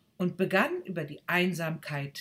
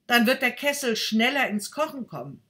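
put-on louder boy with a plan; 8 semitones higher, 10 dB louder, faster than usual. Second boy proposes burning the stick, louder. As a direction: matter of fact.

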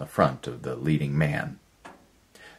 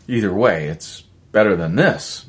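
eager and pressing, a bit louder; fervent; 5 semitones higher, 6 dB louder than usual. A narrator's reading. Miller to Erickson, fervently wanting out. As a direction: pour conviction into it. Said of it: "Miller said fervently".

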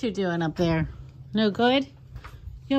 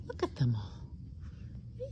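slow, softer breathy whisper; slow hushed whisper; 8 semitones lower, 10 dB quieter, much slower than usual. Real, not acted. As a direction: hush it in awe. Whispering to dog.

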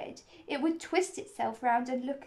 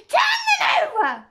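high, very loud furious command; angry shouted order; 13 dB louder than usual. A narrator's reading. Queen orders the knave about in an angry shout. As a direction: shout it angrily, pitched right up. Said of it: "The Queen turned angrily away from him".

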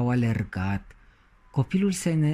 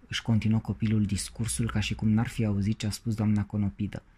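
plain reading voice

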